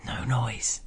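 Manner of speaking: hushed, breath-led whisper; whispered warning. A narrator's reading. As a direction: whisper it as a close, secretive warning. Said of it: "leaning forward to bite and kiss and whisper".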